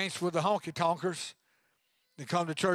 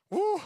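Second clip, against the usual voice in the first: high, loud joyful woo; excited whoop; 13 semitones higher, 4 dB louder than usual. Real, not acted. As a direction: let out a gleeful whoop. Joke about senior discount.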